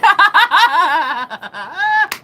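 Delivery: high, loud cackle running on; real peal of high laughter; loud laughing fit. Real, not acted. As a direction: burst out laughing at your own story. Cracks up at own story.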